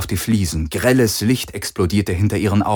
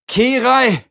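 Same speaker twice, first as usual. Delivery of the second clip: much higher, louder yell; shouting; 4 dB louder than usual. A narrator's reading. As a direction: scream her name in panic. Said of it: "schrie er".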